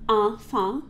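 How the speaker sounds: enfant with nasal ah vowels, no n; French nasal enfant. Shown as a demonstration. Teacher modeling enfant for students, nasal French vowels. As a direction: sing enfant with pure dark nasal vowels. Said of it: "Say that with me".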